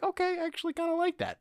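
squeaky high falsetto, pleasantly surprised; a playful high-pitched take, amazed that he likes it; mock high voice. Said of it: "I'm like".

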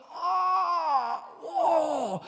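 loud, high wail of ear pain; pained cry. Retold or imitated. Preacher reenacting his ear pain on the flight, crying out. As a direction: cry out in pain as the plane lands. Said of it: "Ich saß so da".

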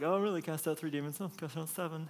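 lightly voiced rivals comparing scores; mock bragging disciples. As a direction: disciples showing off to each other, softly mocked. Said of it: "They were comparing notes".

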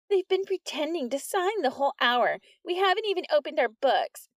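put-on high tattling girl voice; high, eager snitch; whiny Polly. A narrator's reading.

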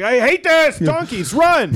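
high, loud mock-panicked shout; hammed-up frantic command; Jesus panicking. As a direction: yell it high, fleeing in a tizzy. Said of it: "Jesus is like".

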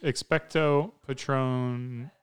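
Expecto Patronum drawn out slowly, each syllable apart; slow careful spell. Shown as a demonstration. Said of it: "Better just say that quick".

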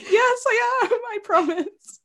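very high, slow insistent promise; high pleading. Retold or imitated. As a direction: plead to be believed, slowly.

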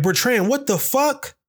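high, drawn-out shocked call; mom yelling his name. Retold or imitated. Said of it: "My mom just yelled my name like".

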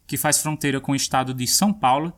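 ordinary delivery

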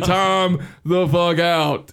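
slow, drawn-out comic disbelief; emphatic retold alarm. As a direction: stretch every word in mock alarm. Retold alarm at hearing the sound.

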